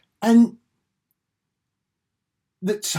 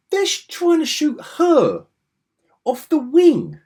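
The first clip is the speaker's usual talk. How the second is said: high, loud gleeful telling; excited; 13 semitones higher, 8 dB louder than usual. Real, not acted. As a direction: rattle it off with glee. Excited outrage.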